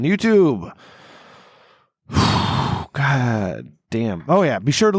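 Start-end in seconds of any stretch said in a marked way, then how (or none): 2.1-2.9 long, voiceless blown-out breath; heavy sigh. Real, not acted. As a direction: let out a big exhale. Host's long audible exhale.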